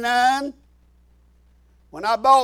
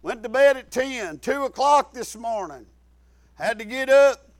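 ordinary delivery

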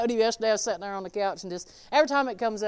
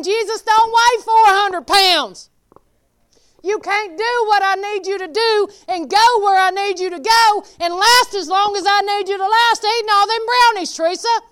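louder, higher scolding spirit; inner spirit's voice; 10 semitones higher, 10 dB louder than usual. Retold or imitated. Her spirit scolding her about brownies, loud and urgent. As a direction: warn yourself as your spirit. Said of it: "But my spirit is saying".

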